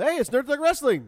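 high excited radio shout; hyped intro. Real, not acted. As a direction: announce the show with energy. Show intro hype.

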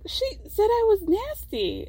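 high, slow imitated complaint; mock complaint. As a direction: play the wounded friend, drawn out and high. Acted hurt friend's complaint.

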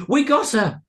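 loud, high triumphant cry; exclaimed. A narrator's reading. Boy exclaiming to his gang inside the house, exclaimed. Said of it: "exclaimed Edward Ponsington".

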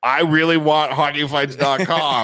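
high, loud, drawn-out wanting; mock desperate eagerness. Tipsy buyer telling the owner over phone, loud desperate eagerness. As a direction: blurt it out slowly and loudly.

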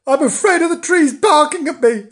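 high, loud kitten confession; afraid. A narrator's reading. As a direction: blurt it out as a frightened kitten. Frightened kitten confesses his fear.

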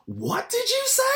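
very high, sharp mock outrage; furious challenge. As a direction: confront him, daring him to repeat it. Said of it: "I was fucking furious".

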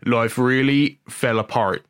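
slow, put-on Norfolk country accent; hammed-up Norfolk yokel accent; Hot Fuzz voice. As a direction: draw it out in mock Norfolk. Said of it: "I'm just doing, like, Hot Fuzz voice".

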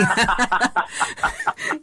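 bright real laugh mid-sentence. Real, not acted. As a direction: let a genuine laugh out. Laughing over shared memory.

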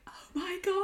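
high, soft starstruck oh my God; awed gasp. Retold or imitated. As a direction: breathe it like meeting your idol. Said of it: "I was like".

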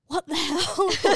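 mock laughing shock. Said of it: "by being like".

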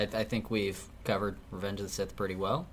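usual speaking voice

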